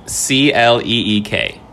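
C L E E K spelled letter by letter; spelling it out. Shown as a demonstration. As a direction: say C L E E K as separate letters. Host spells the quiz word.

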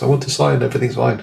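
half-hearted cry at the sky; mock shout to the universe. To the universe on a cold hill, a mock shout. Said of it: "shouting at the universe".

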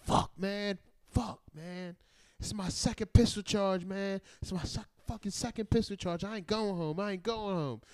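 high, distressed retold whine; imitated despairing young detainee. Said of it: "twenty-year-old fucking dreadhead just".

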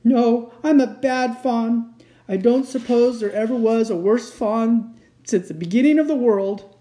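high, loud, wailing misery; sobbing self-reproach. A narrator's reading. Fawn calling himself the worst ever, sobbing. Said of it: "replied Mister Tumnus between his sobs".

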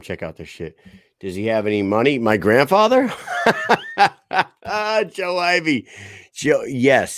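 ordinary talk, nothing marked

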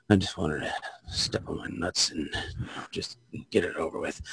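low, breathy whispered plea. Shown as a demonstration. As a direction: murmur it low and defeated. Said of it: "a different inflection on it".

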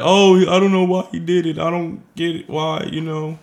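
high, whiny fake innocence; lightly voiced mock victim, playing dumb; mock innocent victim. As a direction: mimic him playing the victim. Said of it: "try to be like".

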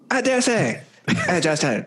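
high-pitched South Park Canadian voice; South Park Canadian accent. Acted. Showing a friend his "British" that comes out South Park Canadian. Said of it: "say that in a British accent".

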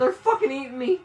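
very high-pitched panicked cry; panicked squeal. Real, not acted. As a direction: squeal as the monkeys attack you. Attacked by game monkeys.

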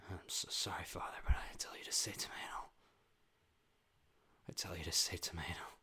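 low, whispered mock-intense apology; hushed TV acting. Son apologising to Father over tomato, hushed TV drama. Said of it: "This is how everyone talks in every show".

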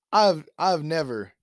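ordinary talk, nothing marked

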